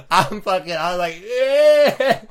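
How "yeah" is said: high, loud mock shudder; squeamish shudder noise. Retelling the shivers at a broken leg, squeamish squeal. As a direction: squeal as if recoiling, half laughing.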